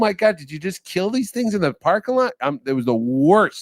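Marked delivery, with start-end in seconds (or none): 0.0-2.3 shocked. Retold or imitated. Telling his friend about the wings, shocked and high. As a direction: recoil at the claws on the wings.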